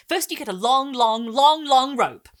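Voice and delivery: high, loud, racing to tell; eager boy. Acted. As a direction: grab the story from your sister. Boy relishing the story.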